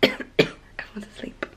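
hushed whisper so nobody wakes. Real, not acted. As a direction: whisper it softly, people sleeping nearby. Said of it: "I'm gonna have to whisper 'cause everyone's asleep".

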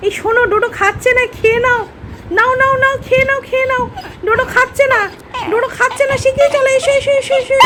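high sing-song coaxing to a dog; high coaxing voice to a pet dog. Real, not acted. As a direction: lure the dog to its bowl in a high sweet voice. Sweet-talking dog to eat.

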